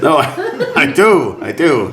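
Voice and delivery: put-on accent breaking into laughter; chatty carriageman. Carriageman admits sleeping at the wheel, comic and chatty. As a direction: protest in character, half laughing. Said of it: "not quite sure what this accent is".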